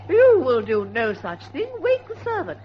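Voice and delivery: loud, firm command; stern refusal. Acted. Aunt forbids her niece to drive, stern command. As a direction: put your foot down.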